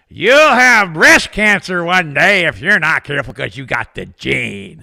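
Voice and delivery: loud, slow scolding doctor; mock doctor voice. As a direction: wag a finger like a preachy doctor. Mocking doctor shaking stethoscope.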